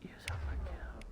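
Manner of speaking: whispering. Real, not acted. Whispering a voice command.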